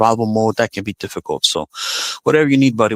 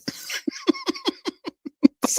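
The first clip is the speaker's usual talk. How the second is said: high squealing laugh; 7 dB quieter than usual. Real, not acted. Laughs at own clumsiness.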